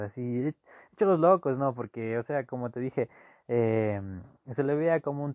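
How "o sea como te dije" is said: normal talking voice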